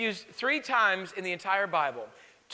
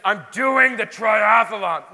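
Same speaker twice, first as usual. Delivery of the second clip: louder and slower, every word set down firmly; stubborn insistence; 8 dB louder, slower than usual. Retold or imitated. Pastor voicing a pregnant trainer who refuses to quit, louder and slow. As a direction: refuse to be talked out of it. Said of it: "And she says".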